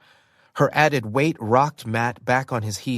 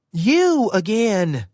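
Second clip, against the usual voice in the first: a high, slow accusing outburst; sharp retort; 9 semitones higher, 3 dB louder, slower than usual. Acted. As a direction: snap back with disbelief. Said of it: "she shot back".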